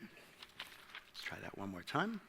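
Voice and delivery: quiet aside to himself, slowed right down; under the breath, fiddling with the clicker; muttered aside. Real, not acted. Speaker mutters to himself as the slide fails, quietly.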